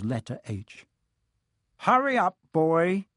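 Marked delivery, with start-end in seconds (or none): 1.8-3.1 high, impatient call from another room; shouting. A narrator's reading. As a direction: call out sharply to hurry someone along. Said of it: "shouted Uncle Vernon from the kitchen".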